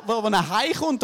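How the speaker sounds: ordinary talk, nothing marked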